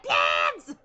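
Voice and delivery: high, loud mock scolding cry; exasperated shout. Scolding the cat tree wreckers, high mock shout. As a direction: yell it like a fed-up mum. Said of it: "I'm like".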